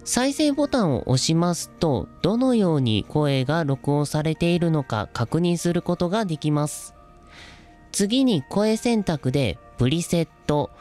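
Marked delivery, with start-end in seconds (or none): none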